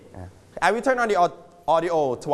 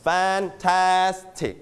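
fantastic very slow, TAS stressed; drawn out fan-TAS-tic for the class; correct stress; 3 semitones higher, much slower than usual. Shown as a demonstration.

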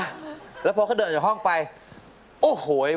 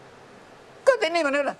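put-on high, slow, whiny kid arguing; whiny child; much slower than usual. Father imitating his quarrelling kid, high and whiny. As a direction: mimic a sulky child blaming his sibling.

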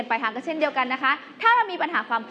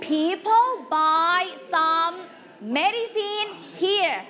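slow, drawn-out teacher reading; very slow model reading; 2 semitones higher, much slower than usual. Shown as a demonstration. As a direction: stretch each word of the sentence out slowly. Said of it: "teacher rereads sentence slowly".

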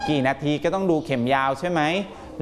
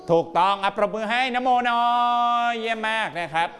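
encouraging praise; 7 semitones higher than usual. Real, not acted. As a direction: cheer the pupil on in a raised teacher's voice. Teacher leads applause for correct pupil.

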